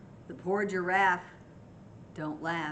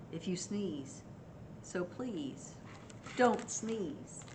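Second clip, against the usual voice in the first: soft pleading; 2 semitones lower, 7 dB quieter, much slower than usual. A narrator's reading. Hippo pleading softly.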